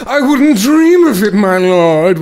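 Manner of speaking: high, loud oily henchman; scheming sycophant voice. Retold or imitated. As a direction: simper like a mustache-twirling schemer. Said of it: "he literally says".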